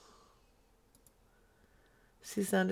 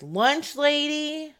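lunch lady voice; 8 semitones higher, 5 dB louder, slower than usual. Pretend. Silly voiced aside.

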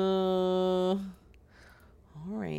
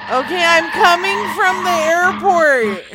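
panicked, higher and louder; frantic yelling; 3 semitones higher, 8 dB louder than usual. Acted. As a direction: yell it in a driving panic. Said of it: "so it sounds like you're frantic".

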